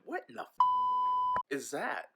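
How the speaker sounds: high startled cry, cut off. Retold or imitated. Man spotting a cat in the garage, startled squeak. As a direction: jump at the sight of a tail.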